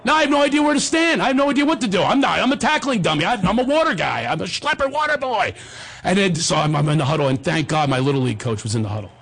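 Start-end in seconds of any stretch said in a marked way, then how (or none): 0.0-5.5 higher-pitched comic fluster; mock panic. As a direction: fret like a rookie out of his depth. Inner panic of his boy self.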